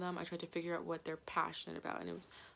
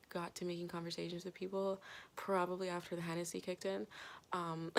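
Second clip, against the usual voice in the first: usual speaking voice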